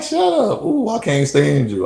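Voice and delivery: high-pitched imitation of a teasing woman; mock playful protest. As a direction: squeal it high, like her playful comeback. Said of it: "she just laughed. She was like".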